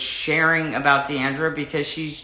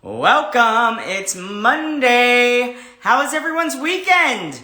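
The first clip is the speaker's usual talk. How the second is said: bubbly welcome; 8 semitones higher, 5 dB louder, slower than usual. Acted. High bubbly host opening.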